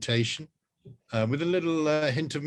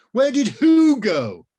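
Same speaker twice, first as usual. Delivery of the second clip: much higher, louder, slow furious yell; angry yell; 13 semitones higher, 11 dB louder, slower than usual. Acted. Rose yelling at the wizard who broke her phone. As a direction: yell it in fury. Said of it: "yelled Rose".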